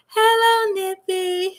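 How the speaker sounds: high sing-song pet greeting; cooing cat greeting. Real, not acted. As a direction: coo high to the kitty.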